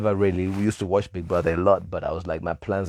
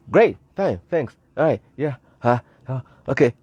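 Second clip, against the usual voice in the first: rushed answer; 4 semitones higher, 4 dB louder than usual. Retold or imitated. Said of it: "I'm answering real quick".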